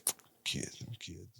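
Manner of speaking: whispered, under the breath; mumbling. Retold or imitated. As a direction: mutter it to yourself. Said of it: "I definitely be mumbling that".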